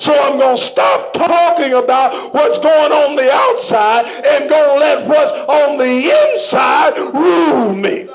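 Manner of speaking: slow, half-sung preacher's cadence; whooping sermon style; preaching. Acted. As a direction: lift the verse up in song-like preaching. Preaching Paul's words.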